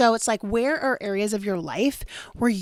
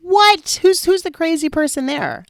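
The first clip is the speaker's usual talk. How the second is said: loud, much higher-pitched disbelief; incredulous outburst; 8 semitones higher, 7 dB louder than usual. Acted. Wife questioning who is really crazy, incredulous. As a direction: burst out in mock astonishment. Mocks her own former blaming.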